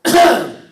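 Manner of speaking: loud stern throat clearing; loud warning ahem. Retold or imitated. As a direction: clear the throat like a strict father. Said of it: "ele só fazia assim, ó".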